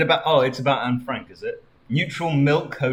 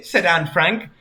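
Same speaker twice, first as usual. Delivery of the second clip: ordinary delivery, nothing marked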